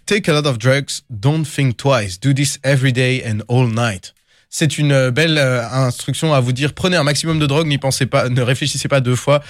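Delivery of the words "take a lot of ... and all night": lyric read in a put-on English accent, slow in the middle; French speaker doing his best English accent. Shown as a demonstration. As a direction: deliver the line like an English rapper, drawing out don't think twice. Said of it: "réciter avec mon plus bel accent anglais".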